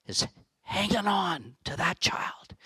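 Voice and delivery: soft whisper. Acted. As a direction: breathe it as a tender whisper. Tender image of mother holding.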